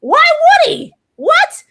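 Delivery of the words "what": squeaky, much higher and louder what; shocked what. Real, not acted. Fan reacting to the twist, shocked squeak. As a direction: yelp it in shock.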